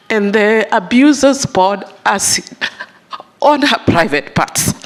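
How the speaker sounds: real distress, voice rising and straining; holding back tears over a horrific detail; emotional. Real, not acted. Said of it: "I don't know why I'm so emotional this morning".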